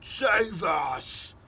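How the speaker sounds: loud panicked giant scream; giant's scream. Acted. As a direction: scream for help in a nightmare. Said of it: "screamed the Flesh Lump Eater".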